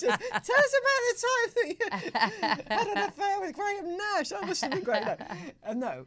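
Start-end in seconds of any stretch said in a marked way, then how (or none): 0.0-5.0 high, loud, gushing fangirl voice; gushing fan. Retold or imitated. Teasing herself, a high loud gushing fan to [name]. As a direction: squeal it like an overexcited fan.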